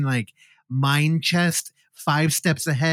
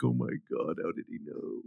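very soft, lower imitated dismay; mock crying; 6 semitones lower, 13 dB quieter than usual. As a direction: let it fade to a voiceless murmur. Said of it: "the dude's crying".

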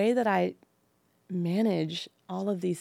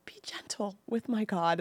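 whispered, tender reproach; hushed, protective chiding; dad's gentle plea; 2 semitones higher, 3 dB quieter than usual. Retold or imitated. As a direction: imitate a dad pleading in a whisper. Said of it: "but he's like".

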